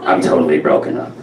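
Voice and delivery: low, flatlined mom impression; Harvey Fierstein-like mother voice; Rona. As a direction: claim heartbreak in total deadpan. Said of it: "this is how she talked".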